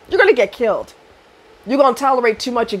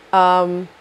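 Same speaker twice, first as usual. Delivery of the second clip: usual speaking voice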